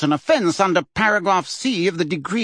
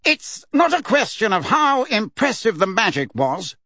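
testy; 8 semitones higher than usual. A narrator's reading. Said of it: "said Fudge in a testy voice".

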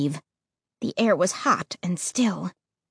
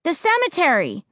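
higher call over the shoulder; calling back; 8 semitones higher than usual. Acted. Narrator answering Ray from ahead, called out higher. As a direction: toss the answer back up the road. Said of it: "I called back".

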